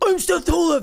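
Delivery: high, over-the-top parody of yourself; hammed-up self-mockery; self-impression. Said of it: "doing an impression of myself".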